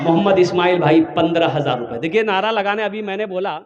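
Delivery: ordinary delivery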